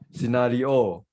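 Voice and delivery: "scenario" with the last O stressed and long; wrong final stress. Shown as a demonstration. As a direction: say "scenario" as see-na-ree-OH. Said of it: "the final syllab-, syllable".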